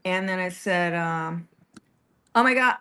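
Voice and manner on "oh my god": sudden higher, louder gasp of surprise; blurted out. Retold or imitated. Caller meeting a Palestinian girl, blurting in surprise. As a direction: let it slip out in shock. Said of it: "I just blurted out".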